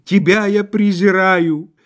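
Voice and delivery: high, loud chanted lament in verse; drawling whine. A narrator's reading. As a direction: intone it like tragic iambic verse, wailing. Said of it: "в том же тягучем тоне".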